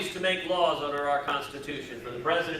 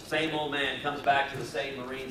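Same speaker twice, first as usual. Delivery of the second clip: usual speaking voice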